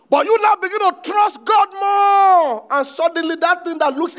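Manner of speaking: full-force preaching. Acted. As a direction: thunder it at the congregation.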